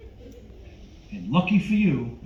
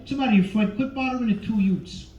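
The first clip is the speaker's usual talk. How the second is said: pissed-off, higher-pitched wise guy; irritated tough-guy impression, raised in pitch; Joe Pesci; 6 semitones higher than usual. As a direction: complain testily, pitched up. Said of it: "So you see Pesci all pissed off".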